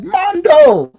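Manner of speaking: high, loud, delighted call of a name; happy greeting. Retold or imitated. Greeting his good friend outside the store, high excited call. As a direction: greet an old friend with real joy. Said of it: "And I'm like".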